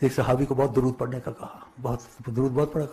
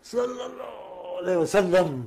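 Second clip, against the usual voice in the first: sleepy murmur; 3 semitones higher, slower than usual. Shown as a demonstration. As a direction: let it slip out while turning over in sleep. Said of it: "Karot لو، تو منہ سے نکلے". (نیند میں بڑبڑاتے ہوئے)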